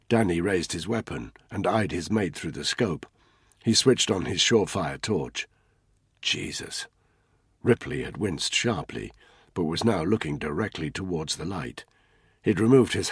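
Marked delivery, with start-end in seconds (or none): 6.2-6.9 shocked whisper. A narrator's reading. Shocked reaction in narration.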